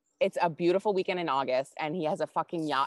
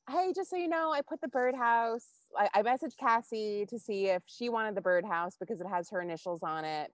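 higher, put-on breezy voice; put-on casual address; 6 semitones higher than usual. Walking up to her ex at the gym, put-on casual. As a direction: act nonchalant in a high, airy pitch.

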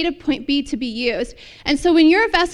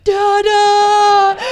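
put-on loud, drawn-out toddler shout; big gleeful kid call, held long; little boy's joyful voice; 6 semitones higher, 9 dB louder than usual. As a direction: imitate a small boy hollering for his dad. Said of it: "you hear his deep little voice".

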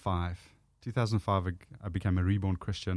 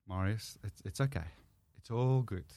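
soothing self-talk; 5 dB quieter than usual. Retold or imitated. Calming self in the mirror.

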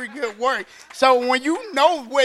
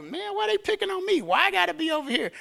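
high-pitched mock complaint; higher, plaintive why-me grumble; self-pitying complaint; 7 semitones higher, faster than usual. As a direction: play a put-upon prisoner, pitched up. Imagined self-pitying prisoner complaining.

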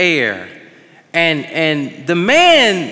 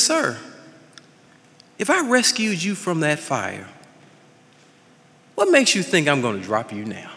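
softer, lower, unhurried hero's answer; calm reassurance; 5 semitones lower, 7 dB quieter than usual. Acted. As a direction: settle him down, gently amused. Superman reassures the frightened man.

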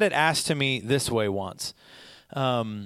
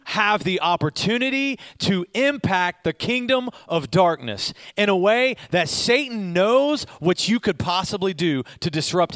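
higher, louder, driving sermon voice; preaching at full force; 4 semitones higher, 3 dB louder than usual. Acted. Preacher rousing the congregation against Satan, louder and higher. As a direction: build the fire and push every line out.

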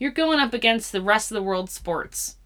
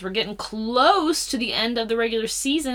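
usual speaking voice

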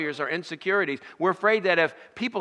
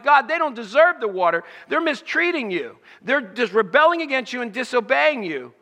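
higher, louder indignant protest to God; angry complaint; 5 semitones higher, 4 dB louder than usual. Retold or imitated. Moses complaining to God about the people, heated and indignant. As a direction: vent the grievance at God. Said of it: "He was angry toward God".

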